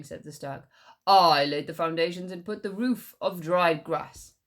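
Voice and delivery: loud arguing; quarrelling. Acted. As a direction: argue back and forth. Quarrel over house.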